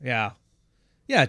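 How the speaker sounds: ordinary delivery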